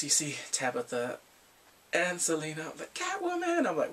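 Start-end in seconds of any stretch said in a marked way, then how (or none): 2.9-3.7 high thrilled shout of the name; high excited cry; excited exclaim. Retold or imitated. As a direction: call it out like a fan spotting her. Excited naming of character.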